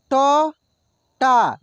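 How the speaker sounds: normal talking voice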